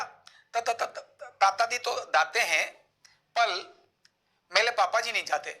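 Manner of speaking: t for ch, d for j, l for r; lisping child. Shown as a demonstration. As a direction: imitate a lisp, ch and r turned to t and l. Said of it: "तो तुतलाने बोले वाला बोलेगा".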